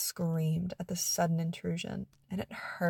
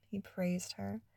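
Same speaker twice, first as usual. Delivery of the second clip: usual speaking voice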